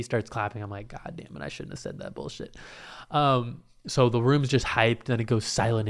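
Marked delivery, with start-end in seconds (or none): 0.9-2.6 very low, hushed grumble; regretful muttering. Retold or imitated. Regretful thought on stage.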